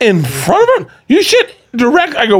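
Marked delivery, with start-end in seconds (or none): 0.0-0.9 incredulous repeat. Retold or imitated. Shocked question repeated. 1.1-2.1 high-pitched incredulous question; hammed-up shock, voice shooting up; appalled disbelief. Imitating a friend grilling a married man, appalled.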